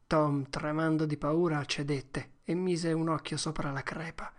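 ordinary delivery

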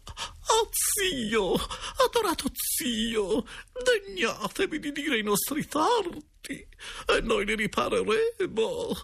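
very high, slow, wailing falsetto; hammed-up high-pitched supplication; imploring directress. A narrator's reading. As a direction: beg tearfully in a drawn-out falsetto. Directress begs the ghost's forgiveness.

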